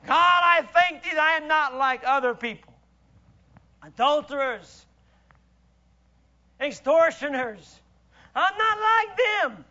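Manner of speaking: proud, loud self-righteous prayer; Pharisee praying. Retold or imitated. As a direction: pray loudly, showing off your virtue. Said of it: "he is praying up a storm".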